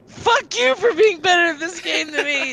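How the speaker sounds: yelling while laughing; laughing shout. Real, not acted. Teasing friend, laughing.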